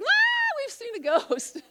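high panicked scream into a laugh; mock-terrified fishermen; panicked disciples. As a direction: shriek like you've seen a ghost. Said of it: "they're panicked".

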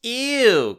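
high squeal of disgust; girl's cry. Acted. Girl squeals at the alien in disgust. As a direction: squeal it, grossed out. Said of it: "Katie cries".

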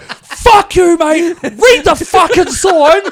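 high, loud road-rage yell, stretched out; acted-out furious outburst; snapped shouting. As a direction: bellow it at the other driver, pitch way up, laughter creeping in. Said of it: "just snapped".

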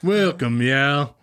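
Southern drawl greeting; hammed-up Southern hostess; Southern accent. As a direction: welcome them in a put-on Southern twang. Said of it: "yeah, more Southern".

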